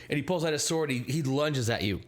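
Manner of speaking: ordinary talk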